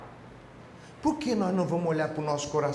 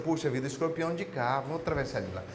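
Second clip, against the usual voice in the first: musing to himself; 5 semitones lower, 5 dB quieter than usual. Acted. Frog thinks to himself.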